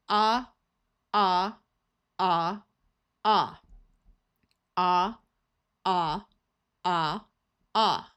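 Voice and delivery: Ah held as an open ah vowel; the ah sound. Shown as a demonstration. Teacher showing the ah sound for AU and AW, clear and steady. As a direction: say ah with the mouth wide open. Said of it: "both have the sound of ah".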